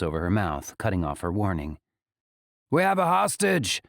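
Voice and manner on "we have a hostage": high shouted threat; roar. A narrator's reading. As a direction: bellow the warning down the stairs. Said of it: "Acheta roars".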